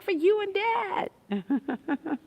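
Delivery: slow high playful answer; high sing-song reply. Retold or imitated. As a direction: stretch it out, high and teasing. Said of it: "I was like".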